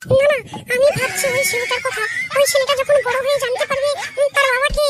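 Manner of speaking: high female voice, concerned; worried wife. Acted. Wife frets to husband about the boy's future, high and anxious. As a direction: fret over the poor child's future.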